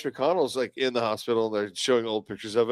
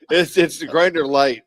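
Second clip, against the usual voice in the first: high laugh, then slow words; 3 semitones higher, 4 dB louder, slower than usual. Real, not acted. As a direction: laugh through the punchline. Laughing at own joke.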